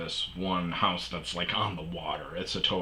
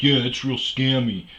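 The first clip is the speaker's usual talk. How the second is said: higher and louder, breezy agreement; mock landlady; 6 semitones higher, 9 dB louder than usual. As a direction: toss it off like it's no big deal.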